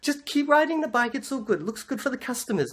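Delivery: high-pitched put-on shopkeeper voice; French shopkeeper. Shopkeeper letting the rider stay, high imitated voice. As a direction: imitate her in a high, knowing voice.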